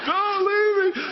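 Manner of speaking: high, loud, hammed-up childish wail; a boy shouting down the phone for mom, mock-pleading. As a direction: wail like a scared kid who wants his mom. Child begging mom to stay.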